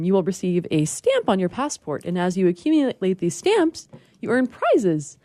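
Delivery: normal talking voice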